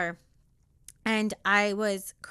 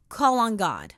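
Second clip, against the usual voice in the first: usual speaking voice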